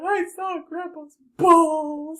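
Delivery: high, loud, drawn-out announcement; mock-horrified report, about to crack up; imitated scandalized niece. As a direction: stretch it out in hammed-up shock. Retold shocked announcement of the niece.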